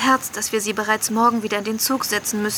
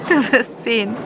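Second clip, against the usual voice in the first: ordinary talk, nothing marked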